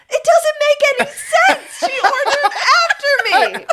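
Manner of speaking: high, loud laughing yell of a wronged customer; mock outraged shout. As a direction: yell it through a car window. Customer arguing with worker.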